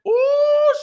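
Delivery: high, loud drawn-out ooh; shocked ooh. Real, not acted. Reacting to Drew calling Sheree too old, high loud ooh. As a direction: squeal a scandalised ooh.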